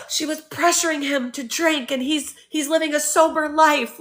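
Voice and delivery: gasp, then mimic horrified critics; mock outrage. Mocks everybody's shocked accusation.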